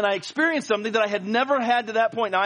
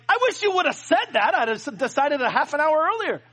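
higher, animated mock outrage; a hint of playful complaint, pitched up; excited exclamation; 6 semitones higher than usual. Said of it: "And I was like".

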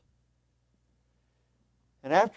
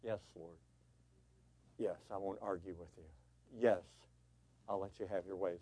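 humble surrender; 4 semitones lower, 9 dB quieter than usual. Pretend. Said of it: "say in humble obedience".

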